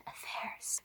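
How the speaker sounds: gossipy whisper of the naughty word. Pretend. Booktuber confiding scandal to viewers in whisper. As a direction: breathe it out like a secret.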